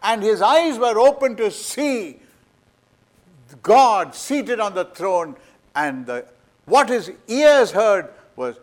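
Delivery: loud, high, impassioned sermon voice; thundering pulpit preacher; full-force preaching. Acted. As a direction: preach it with rising fire. Preaching the vision of Isaiah.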